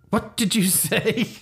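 high, slow startled question; double take. Acted. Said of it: "Little double take".

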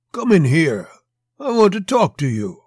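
loud, high-pitched summons; commanding big man. A narrator's reading. Massive Mexican orders Monty in.

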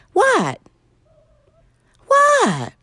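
high-pitched "what" dropping sharply; squealed pet-talk question; animal voice. Real, not acted. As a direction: call to a pet in a high, falling voice. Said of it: "That's my animal voice".

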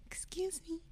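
tiny, high, very soft squeak; high-pitched, hushed little voice; timid put-on voice. Shown as a demonstration. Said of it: "prepare myself for this voice I put on".

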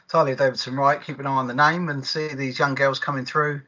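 normal talking voice

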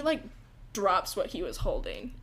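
usual speaking voice

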